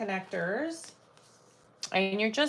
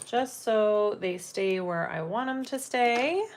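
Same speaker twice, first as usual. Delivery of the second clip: ordinary talk, nothing marked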